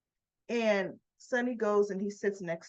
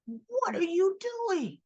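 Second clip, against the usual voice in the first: high, long-drawn incredulous question; imitating an exasperated confrontation; exasperated demand; 9 semitones higher, much slower than usual. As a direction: stretch it out high, as if scolding. Man confronts yelling woman.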